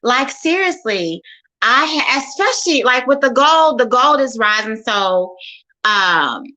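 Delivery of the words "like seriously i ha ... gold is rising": much higher and louder, eager; excited bragging. Real, not acted. Investor answering how her investing has gone, excited. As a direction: tell it like great news.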